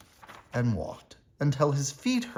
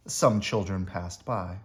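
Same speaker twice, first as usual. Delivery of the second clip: plain reading voice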